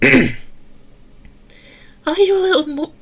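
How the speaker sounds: very high falsetto little voice; girl voice. A narrator's reading. Narrator attempting Eleanor's question to Sarah, tinny girl voice.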